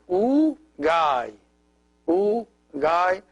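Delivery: U Gai as two long separate beats; joined syllables. Shown as a demonstration. Teacher puts the word for bye together, U Gai sounded out. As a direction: sound out U, then Gai, unhurriedly. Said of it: "So it is".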